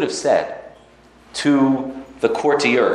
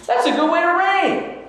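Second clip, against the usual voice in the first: admiring exclaim. Acted. Praising the king's way of ruling.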